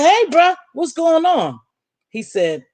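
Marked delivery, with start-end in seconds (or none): 0.0-1.6 bright casual greeting. Retold or imitated. Casual greeting to her friend.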